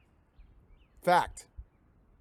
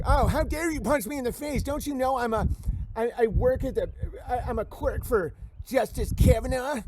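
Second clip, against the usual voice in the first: high, prissy outraged voice; mock indignant snob; 8 semitones higher than usual. Streamer mocking a self-important banned chatter, high prissy indignation. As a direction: squeal the outrage like a pompous snob.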